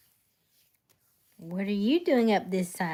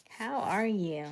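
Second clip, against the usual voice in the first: usual speaking voice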